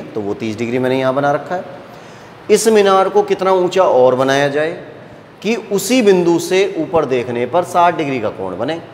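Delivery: ordinary talk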